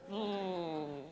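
ordinary talk

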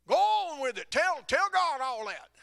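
high, loud egging-on yell; urging shout. Retold or imitated. Eager man urging on prayer.

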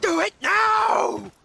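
high, slow bellowed order; shouted command. Pretend. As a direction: bellow it out, drawn long. Egging on, yelling "do it.

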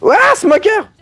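sudden high yelp of alarm; startled cry. Real, not acted. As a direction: shout it as it jumps out. Sudden special zombie appears.

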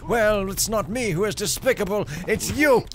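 loud, high mock yell; childish retort. Imagined hammer follower shouting back, childish mock yell. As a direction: shout it back like a playground comeback. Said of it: "And the hammer goes".

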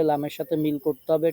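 normal talking voice

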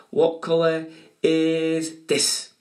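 slow teasing question. Acted. Drawn-out quiz question to kids.

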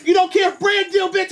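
usual speaking voice

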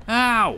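sharp high yelp of pain. Real, not acted. Yelping as a fish hits her boat.

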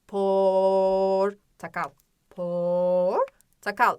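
"Portakal" with a very long first o, then a quick "takal"; stretched O. Shown as a demonstration. Teacher highlighting O for pupils, long stretched o in "Portakal". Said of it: "Neyi vurguluyorum? O seslerimi".

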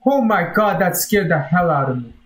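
ordinary talk

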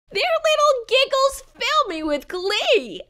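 squeaky little voice full of glee; gleeful fart. Acted. Tiny fart at the mic, high and delighted. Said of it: "To the mic each fart floated".

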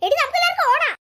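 put-on a child shouting in panic at a kidnapper; panicked shout. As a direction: yell no and urge everyone to run. Girls shout refusal and flee.

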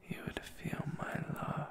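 ordinary talk

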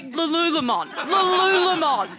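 Lululemon drawn out as luh-LOO-luh-mon; mispronounced brand name. Shown as a demonstration. Comic to fellow offender in audience, Lululemon mispronounced as luh-LOO-luh-mon. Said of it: "Why would it be Lululemon?".

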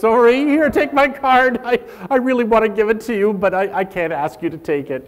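high, loud, rushed apologetic babble; over-apologetic Canadian. Retold or imitated. Presenter mocking an over-apologetic Canadian handing out a business card. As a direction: gush apologies while pushing a card. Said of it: "the Canadian".

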